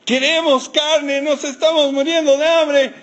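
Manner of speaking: high, slow, wailing demand; mock whining crowd with a laugh. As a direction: wail it like hungry complainers. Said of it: "Los escuchaba llorar".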